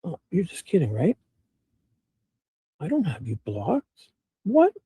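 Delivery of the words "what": surprised. Real, not acted. Shocked at a Discord message.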